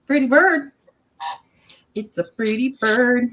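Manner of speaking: high, slow cooing at the bird. Real, not acted. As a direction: coo it like talking to a pet. Baby talk to pet bird.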